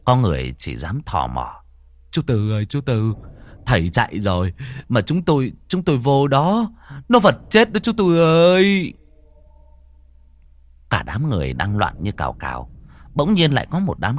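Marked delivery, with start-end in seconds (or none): 2.1-8.9 frightened calling. Acted. Neighbour calling out in fear.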